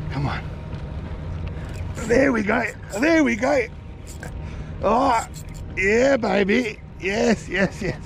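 ordinary talk, nothing marked

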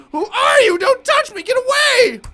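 high, loud frightened shrieking; terrified scream. Acted. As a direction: scream at him to keep away. Mother screams at stranger.

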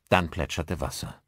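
ordinary delivery, nothing marked

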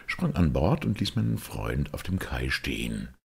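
ordinary delivery

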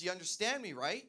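heightened insistence. Acted. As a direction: push for agreement with mounting impatience. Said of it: "start heightening it if you don't answer".

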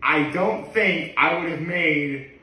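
usual speaking voice

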